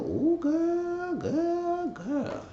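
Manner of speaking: put-on very high squealing ooh girl; mock squeal. Reacting to a friend's big case, high gossipy squeal. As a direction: squeal it like juicy gossip just landed.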